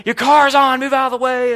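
high, loud mock yell of an irate driver; imitated yelling. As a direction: yell it like the angry driver behind. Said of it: "the guy's yelling at me".

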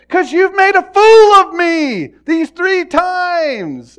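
very loud, high, drawn-out shout; acted full-voiced outrage; outraged Balaam. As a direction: bellow back at the donkey, stretching the words. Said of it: "he talks back and says".

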